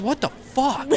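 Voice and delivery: high-pitched line breaking into laughter; laughing disbelief. Acted. Game master voices baffled thug to player, laughing disbelief. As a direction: crack up while voicing disbelief.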